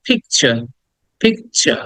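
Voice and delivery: "picture" as PIK-cha, no final r; ch pronunciation. Shown as a demonstration. Teacher explaining the t-u rule, careful ch pronunciation. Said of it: "চ এর মতো উচ্চারণ করি".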